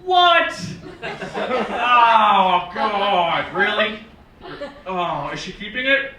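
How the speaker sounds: appalled, tactless disbelief; dismayed reaction. Shown as a demonstration. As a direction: react to news with blurted horror. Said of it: "And not".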